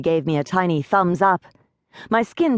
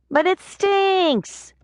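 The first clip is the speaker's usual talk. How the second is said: high, slow drawn-out whine; whiny pixie; much slower than usual. A narrator's reading. Pixie whining to Rachel about the smell, high and slow. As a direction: stretch the complaint out, high.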